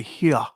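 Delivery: "Here" as "hee-ah", no R; imitated regional pronunciation. Host showing how they pronounce it, "Here" as "hee-ah". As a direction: say "Here" ending in an open "ah". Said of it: "like, where they pronounce".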